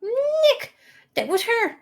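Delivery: bright, high and eager; high-pitched girl voice. Acted. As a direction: squeak it out like a teen girl. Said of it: "My voice is not built for high pitch".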